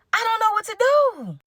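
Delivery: high, drawn-out wail of indecision; hammed-up helpless whine; dramatic helplessness. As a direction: wail it in mock despair. Torn, dramatizing her dilemma.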